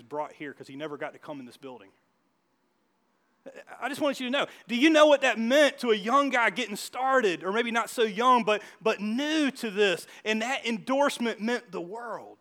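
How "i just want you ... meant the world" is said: loud, slow, high-pitched preaching; a pastor pouring his heart into the point; preaching at full force. Acted. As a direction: drive it home to the congregation, slowing down. Preacher builds to an emphatic point.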